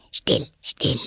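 urgent whispered shushing; hushed. A narrator's reading. Rabbit glancing anxiously round to silence Alice, hushed. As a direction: hush them under your breath. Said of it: "in einem leisen, schnellen Ton".

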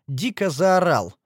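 plain reading voice

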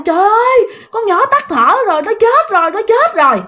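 very high, wailing lament; wailing mother. Retold or imitated. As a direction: cry out that the child is dead. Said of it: "bà ngoại rồi mẹ la quá trời la". (mẹ và bà than khóc, giọng rất cao)

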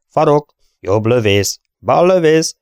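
loud rapid roll call; crew callout. A narrator's reading. Gunners reporting their stations, loud callout. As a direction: sound off each station.

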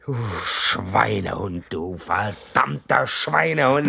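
slow, deliberate cold contempt; contemptuous insult. Acted. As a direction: spit each word slowly. Betrayed husband insults friend.

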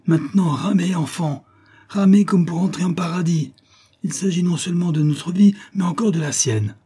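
high, pressing rallying call; urgent command. A narrator's reading. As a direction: urge the rowers on for their lives. Officer urging his rowers to flee. (exhortation pressante, voix haute)

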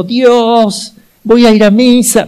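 high desperate shout to God; desperate cry. Retold or imitated. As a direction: cry out to God trapped in the fire. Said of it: "Y gritó". (grito desesperado hacia Dios)

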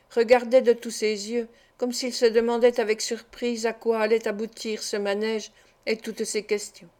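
ordinary delivery, nothing marked

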